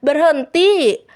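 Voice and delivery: loud, high urgent command; acted forceful cry; shout. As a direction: yell to halt a runaway. Laura yells while chasing Kira.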